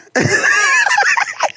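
very high squealing laugh; uproarious laugh. Real, not acted. Host cracks up after the accent bit, squealing with laughter.

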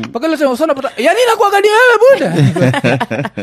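very high, loud hyped-up radio persona; loud, high comic radio voice; radio character. Acted. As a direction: play a shrieky, fast-talking radio character. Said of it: "That's my radio character".

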